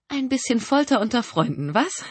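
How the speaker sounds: deutlich höher, gespielt munter; tu fröhlich, obwohl dir bange ist; aufgesetzte Heiterkeit. A narrator's reading. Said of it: "mit aufgesetzter Heiterkeit". (gespielt heiter und hoch)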